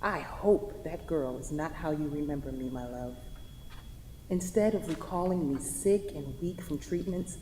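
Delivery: quiet, tender voice-over reading her own letter. Acted. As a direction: speak your last letter calmly to him.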